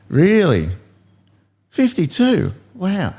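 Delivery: put-on high, amazed boyish voice; a small boy who can't believe it; amazed little kid. Kid amazed at his age.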